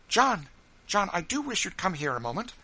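high, loud, urgent call to a husband; quick, earnest. A narrator's reading. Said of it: "in a quick, earnest tone".

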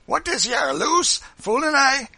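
loud, high brutal bark; guttural, bullying shout; brutal guttural tones. A narrator's reading. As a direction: snarl it like a cruel overseer. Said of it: "said in brutal guttural tones".

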